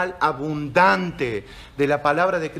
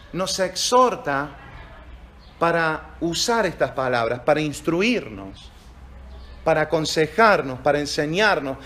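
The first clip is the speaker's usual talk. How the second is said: ordinary talk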